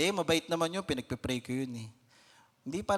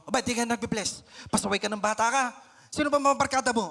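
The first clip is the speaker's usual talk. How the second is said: put-on rising angry scold at a child, louder and higher; scolding parent; 7 semitones higher, 5 dB louder, faster than usual. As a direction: snap at the kid who forgot to bless. Parent scolds child, stressed from work. (pagalit na sigaw ng magulang)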